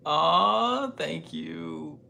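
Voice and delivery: high, loud touched "aww". Real, not acted. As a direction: melt at the kind words. Touched by a viewer's comment.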